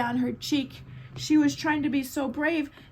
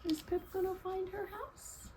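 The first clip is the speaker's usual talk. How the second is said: much softer, higher worried question; hushed question; 6 semitones higher, 13 dB quieter than usual. Real, not acted.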